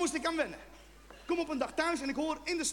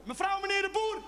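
calling out; 8 semitones higher than usual. Shown as a demonstration. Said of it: "als je ze buiten gaat roepen".

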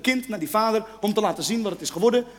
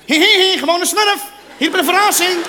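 insistent shout; 6 semitones higher, 5 dB louder than usual. Retold or imitated.

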